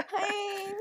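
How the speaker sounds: squeaky high greeting; high-pitched hi. Retold or imitated. Friend mocking a girl's pitchy voice for a crush. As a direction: pitch it way up and sweet. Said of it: "You're like".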